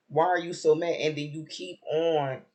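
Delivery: ordinary delivery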